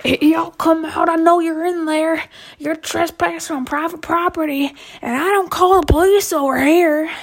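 lightly voiced country man calling into the bushes. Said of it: "I cannot mimic his redneck voice".

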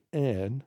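"airn" as the local word for "ours"; "airn", rhyming with bairn; Earthlingborough dialect. Shown as a demonstration. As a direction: say "airn" with an open AIR vowel and final N. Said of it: "You would say".

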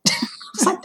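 breathy laugh, high and soft; laughing softly, high. Real, not acted. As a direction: laugh at yourself. Laughing at herself.

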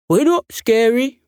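friendly dog voice. Acted. Dog speaks through letterbox.

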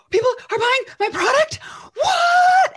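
high, loud, disbelieving shout; astonished shout. Retold or imitated. Shouting in disbelief as her first sales come in. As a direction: cry out in shocked joy at the news.

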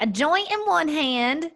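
hammed-up imitation of mom; imitating mom. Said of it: "Mom's like".

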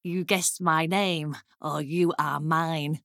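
low imp voice; low, sinister little deal-maker; bargaining imp. Acted. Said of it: "the imp looked at her".

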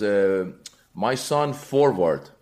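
slow, halting immigrant dad telling the coach; dad's accented English, spoken slowly; dad's broken English. Retold or imitated. Dad insists to the coach in broken English.

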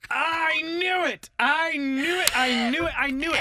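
high, excited I knew it; triumphant yelling; excited shout. Real, not acted. Shocked reaction to the story.

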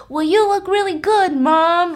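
a hint of a nerdy little boy. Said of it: "Like, one of those nerdy boys".

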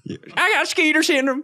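put-on high, far-off old codger voice; old man voice. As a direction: whine it like a bitten old man.

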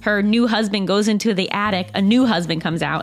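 upbeat sponsor read, clear and even. Acted. Host reads a book club ad to listeners, upbeat ad read. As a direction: sell the book club like a friend.

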